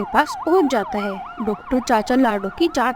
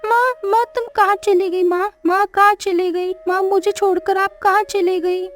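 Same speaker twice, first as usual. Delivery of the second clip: crying for mama; 5 semitones higher than usual. Acted. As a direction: wail for a missing mom, voice pitched up. Child crying for lost mother. (माँ को पुकारता रोता बच्चा, ऊँची आवाज़ में)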